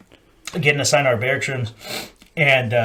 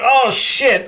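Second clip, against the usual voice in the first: mock panic; 9 semitones higher, 4 dB louder than usual. Marine caught by yelling sergeant.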